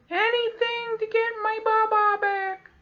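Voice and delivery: put-on very high, slow toddler voice; squeaky baby voice. Old guy on the wall to the kids, very high slow plea. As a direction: plead like a tiny child. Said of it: "he's like".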